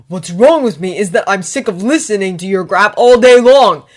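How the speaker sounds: loud heated rant; angry shouting. Acted. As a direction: spit out every grievance. Ron and Harry quarrelling.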